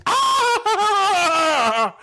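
long, high, unmanly scream; high-pitched scream. Retold or imitated. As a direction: let out a sustained high wail, played for laughs. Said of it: "I screamed".

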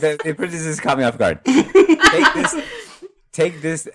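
cracking up mid-sentence; laughing. Real, not acted. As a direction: giggle through the words. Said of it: "the reason I laugh".

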